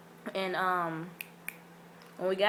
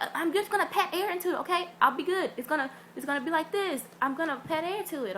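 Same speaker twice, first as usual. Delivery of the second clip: mock pleading friend; 9 semitones higher than usual. Chelsea begging to keep the bag, high and pleading. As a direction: wheedle like a kid promising to behave.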